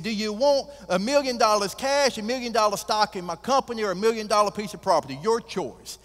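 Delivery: high, loud and fast, full of bluster; a cowboy tycoon honoring his word; booming rancher making good on a bet; proud billionaire. Acted. Billionaire offers the prize.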